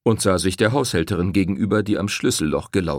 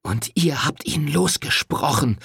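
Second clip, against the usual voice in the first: hissed with hatred; 7 semitones higher than usual. Acted. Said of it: "zischte sie schließlich voller Hass".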